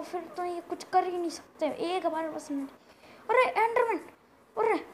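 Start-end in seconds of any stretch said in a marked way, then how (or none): 3.3-4.9 loud, high cry of warning; startled alarm. Real, not acted. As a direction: yelp the monster's name in shock. Spots another Enderman. (घबराकर ज़ोर से चिल्लाते हुए)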